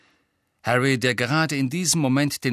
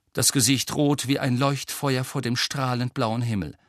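plain reading voice

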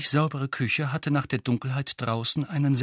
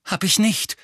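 sharp retort; 8 semitones higher, 4 dB louder than usual. A narrator's reading. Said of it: "sagte Harry scharf".

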